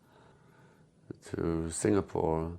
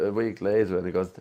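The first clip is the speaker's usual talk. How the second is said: usual speaking voice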